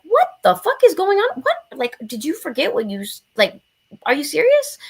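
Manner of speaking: loud, high-pitched disbelief; incredulous outburst. Retold or imitated. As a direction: act out being totally appalled. Acting out her stunned reaction.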